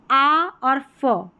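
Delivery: आ held long, then a short फ; separated sounds. Shown as a demonstration. Teacher breaks down the pattern, slow आ, then फ. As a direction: slowly split आ और फ into two sounds. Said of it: "ए का sound आ है".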